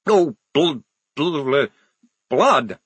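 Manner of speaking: stuck repeatedly on the first sound; stammering. Acted. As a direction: trip over the word, then force it out. Vampire stumbles over the word.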